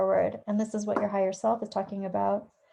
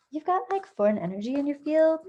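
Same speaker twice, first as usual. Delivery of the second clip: put-on valley girl voice; 5 semitones higher than usual. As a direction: ham up the valley girl twang. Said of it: "I totally didn't mean to sound like a valley girl".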